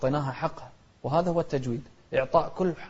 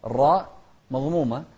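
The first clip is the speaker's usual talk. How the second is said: usual speaking voice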